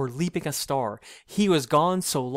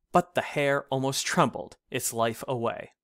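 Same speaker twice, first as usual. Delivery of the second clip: plain reading voice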